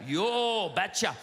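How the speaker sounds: slow, drawn-out Minnesotan Norwegian imitation; thick Norwegian accent. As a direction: stretch it out in a mock Norwegian accent. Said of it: "in his thick Norwegian accent".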